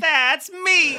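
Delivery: very high, laughing squeak; high-pitched giddy interjection; gleeful squeal. Real, not acted. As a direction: pipe up in a delighted falsetto. Delighted reaction to being mentioned.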